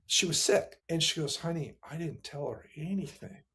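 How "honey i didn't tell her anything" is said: much softer, retold wife's answer; quiet, earnest retold denial; hushed reply.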